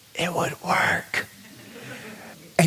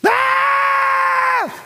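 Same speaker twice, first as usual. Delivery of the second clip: put-on sudden high shrieking child scream; a toddler's piercing wail; child's scream; 4 dB louder than usual. Daughter screaming from her bedroom, high shriek.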